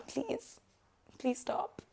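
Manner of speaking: soft, pleading aside to someone off camera; a quick hushed please to someone nearby. Real, not acted. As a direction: quietly beg them to stop, half under the breath.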